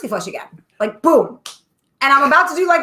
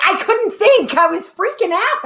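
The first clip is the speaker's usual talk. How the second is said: panicked excuse; 9 semitones higher than usual. Retold or imitated. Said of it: "I was like".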